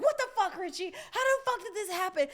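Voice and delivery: loud, incredulous scolding; blaming mom. Retold or imitated. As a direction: imitate an accusing mother. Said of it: "she was blaming".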